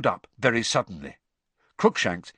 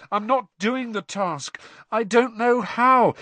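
despairing Harry; 10 semitones higher, 3 dB louder than usual. Acted.

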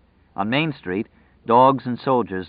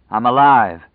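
loud, raised cry of relief; exultant cry; 3 semitones higher, 9 dB louder than usual. A narrator's reading. Escaped man alone under the night sky, exultant. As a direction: proclaim it loudly to the night.

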